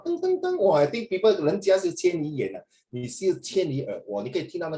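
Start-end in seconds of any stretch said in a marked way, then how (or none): 0.0-0.6 high-pitched playful ding-ding-ding; imitated ringing. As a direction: chime it out like a little bell. Imitates sounds heard from afar.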